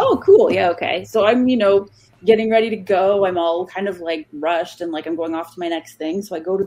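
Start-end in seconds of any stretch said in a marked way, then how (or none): 0.0-1.0 excited. Retold or imitated. Excited reply to the owner's invite.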